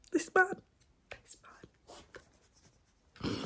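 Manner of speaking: high-pitched swoon over the hero. Real, not acted. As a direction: squeal it, melting over him. Gushing over a book hero.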